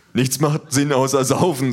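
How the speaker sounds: loud, put-on dopey thug; big thug states his creed loudly. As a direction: slur it like a drinker. Big thug's voice.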